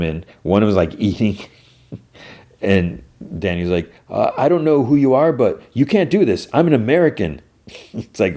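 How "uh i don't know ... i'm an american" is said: higher, flustered protest; pleading captive. Retold or imitated. Daniel protesting to his captors, higher and flustered. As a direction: protest like a scared tourist.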